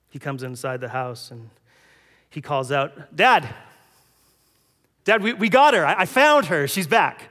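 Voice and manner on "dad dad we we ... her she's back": loud, high call to Dad; excited calling out. Acted. Son calling to his father up the stairs, excited and loud. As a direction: shout the good news up the stairs.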